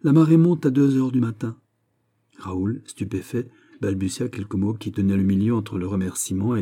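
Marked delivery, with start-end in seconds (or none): 0.0-1.6 high, loud royal announcement; brisk king. Acted. King hurrying the viscount off, brisk. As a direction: state the departure time with kingly authority.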